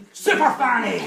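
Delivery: hammed-up loud slow showgirl call, pitched high; loud mock cry. [name] flashing the old man, hammed-up loud cry. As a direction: throw it out like a big reveal.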